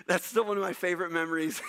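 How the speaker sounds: amused, laughing through the words; real chuckle in the voice. Real, not acted. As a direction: say it while cracking up at a fond memory. Cracks up at his own story.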